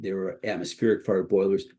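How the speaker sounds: usual speaking voice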